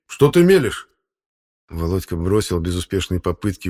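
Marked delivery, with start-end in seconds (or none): 0.1-0.9 high, loud outraged question; indignant. Acted. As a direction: cut him off in outrage. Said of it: "возмутился Фёдор Игнатьевич". (возмущённый выкрик)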